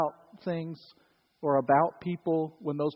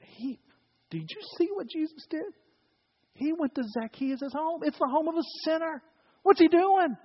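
higher, scandalised gossipy grumble; muttering crowd; 12 semitones higher than usual. Acted. Religious people grumbling about Jesus visiting a sinner, higher and scandalised. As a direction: grumble like offended church folk. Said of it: "They began to mutter and say".

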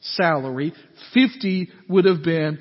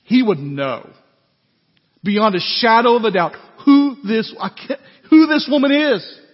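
building from firm to a high, loud outraged "She's a sinner"; Pharisee's inner outrage, louder and higher to the end; indignant outburst; 5 semitones higher, 8 dB louder than usual. Shown as a demonstration. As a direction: let the boil rise into a shout. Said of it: "You can almost hear the internal dialogue of this Pharisee".

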